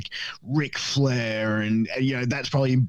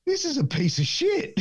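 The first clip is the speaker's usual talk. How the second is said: dad. Retold or imitated. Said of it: "and he goes".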